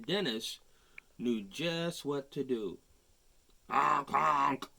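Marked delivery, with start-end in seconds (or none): 3.7-4.7 loud honking noise; horn honk. Acted. Fire truck honking, loud horn imitation.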